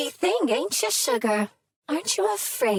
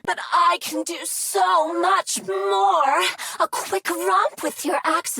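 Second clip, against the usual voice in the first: eager boast; 6 semitones higher than usual. Acted.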